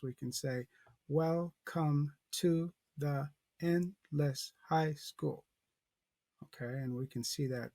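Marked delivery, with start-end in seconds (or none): 1.1-5.5 slow, each syllable separated; counting syllables. Shown as a demonstration. Teacher counts syllables for the class, slow and separated. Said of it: "emphasize to count syllables".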